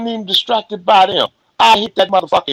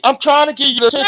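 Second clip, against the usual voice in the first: rushed, raised voice; talking fast; 7 semitones higher, faster than usual. Real, not acted. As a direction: speed up, pushing the words out. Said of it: "you need to slow down".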